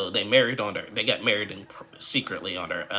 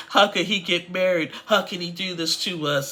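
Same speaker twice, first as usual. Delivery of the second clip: mock wailing mother; 10 semitones higher, 5 dB louder than usual. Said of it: "starts crying and stuff like".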